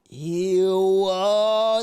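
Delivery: high, loud grossed-out ew; high squeal of disgust, dragging out so cringy. Real, not acted. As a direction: recoil in disgust, voice shooting up. Reacting to creepy text.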